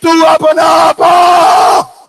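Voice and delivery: high, loud, long-drawn wail; retold wailing plea. Sakina begs father for Abbas, drawn-out high wail. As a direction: stretch the cry out at the peak of lament. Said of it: "رو کے سکینہ کہتی".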